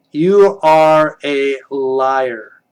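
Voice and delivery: very slow, drawn-out accusation; mock accusation. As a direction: stretch each word in mock accusation.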